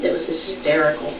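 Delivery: ordinary talk, nothing marked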